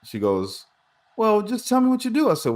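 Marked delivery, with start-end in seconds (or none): 1.2-2.3 much higher, insistent woman; lady at the store demanding an answer, voiced high; mock pushy lady. Voicing the lady, pushing.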